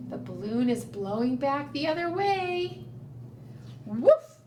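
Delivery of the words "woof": loud short woof; dog bark. Acted. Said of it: "barked the dog".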